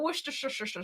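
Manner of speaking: Worcestershire with extra "sher-sher-sher" syllables; weird on purpose. Shown as a demonstration. Teasing co-host with a tricky word, deliberately garbled. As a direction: garble Worcestershire into a run of repeated "sh" sounds. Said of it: "I just do it weird on purpose".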